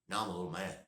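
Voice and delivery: usual speaking voice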